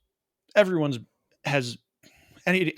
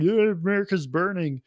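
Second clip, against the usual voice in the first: mock cheer; 7 semitones higher than usual. Russians cheering, mock quote.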